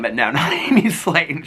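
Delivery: slow, laughing through the punchline. Retold or imitated. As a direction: chuckle out the fan's joke. Retelling fan's joke, laughing.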